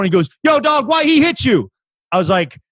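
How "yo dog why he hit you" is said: imitated high-pitched passerby; street bystander. Dude filming a fight asks the victim, street bystander. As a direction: mimic an onlooker calling out his question. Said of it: "the only guy that said anything".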